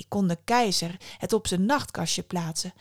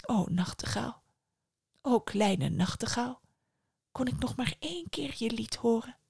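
soft, weak plea from a sickbed; whispering; 2 semitones higher, 5 dB quieter than usual. A narrator's reading. Sick emperor softly begs the nightingale to sing once more. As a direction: breathe the words out, barely there. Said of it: "en fluisterde".

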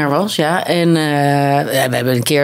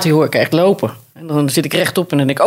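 usual speaking voice

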